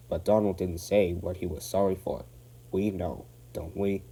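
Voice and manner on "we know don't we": ordinary delivery, nothing marked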